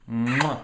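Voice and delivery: loud smacking kiss sound; blown kiss. Real, not acted. Grandparent sending a kiss to Eamon, smacking kiss. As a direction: blow a big kiss down the camera.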